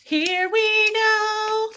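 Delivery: high, slow drawn-out warning; mock-ready for a fight, dragging out "here we go"; slow ominous drawl. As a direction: stretch it out like a showdown is starting. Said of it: "I'd be like".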